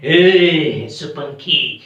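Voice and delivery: loom over the prey, stretching every word; hammed-up villainous creature voice; menacing bat. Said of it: "और वो बोलता है".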